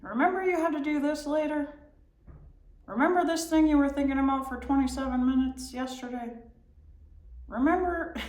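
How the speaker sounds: high, fast, pestering brain voice; nagging inner voice. Retold or imitated. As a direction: nag like a restless mind. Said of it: "reptilian brain is gonna say".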